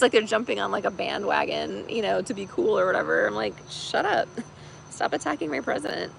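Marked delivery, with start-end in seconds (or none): 3.7-6.1 higher-pitched, playful telling-off; mock indignant retort. Viewer scolding Australian news for attacking the president, mock indignant. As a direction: tell them off in a raised pitch.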